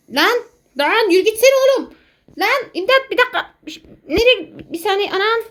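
high panicked calls; being chased. Real, not acted. Calling for help in game.

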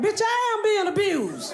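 high, incredulous comeback; indignant kid. Retold or imitated. Girl snapping back at her principal, exasperated. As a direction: throw it back at him, exasperated. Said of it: "I'm like".

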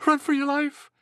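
very high, loud warning yell; storybook alarm at full tilt; panicked rabbit shout. A narrator's reading. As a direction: shriek it as a fleeing rabbit. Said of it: "shouted a rabbit".

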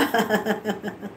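soft, low laughter; scornful chuckle. Real, not acted. Streamer finishing an insult at a rival, scornful laugh. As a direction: chuckle quietly after the insult.

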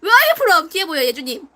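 usual speaking voice

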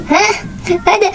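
ordinary talk

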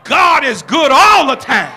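"God is good all the time" shouted loud from the heart; preached out at full force; spirit-filled shout. Shown as a demonstration. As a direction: proclaim it so the whole church feels it. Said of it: "unless that get in your spirit and say".

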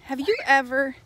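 ordinary talk, nothing marked

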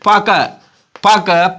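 பக said with a hard middle k instead of h; hard-k slip on பக; common mispronunciation. Retold or imitated. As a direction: repeat பக with a k, as learners do. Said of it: "அப்படின்னு உச்சரிக்கிறீங்க".

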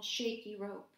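ordinary delivery, nothing marked

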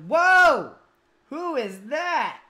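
loud startled whoa; surprised shout. Real, not acted. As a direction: blurt it out in shock. Sudden surprise at new character.

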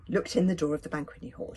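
usual speaking voice

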